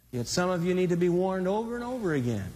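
ordinary talk, nothing marked

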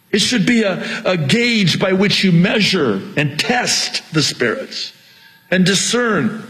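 high, driving preacher's delivery; impassioned preaching. Real, not acted. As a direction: preach with rising urgency. Preaches at full force.

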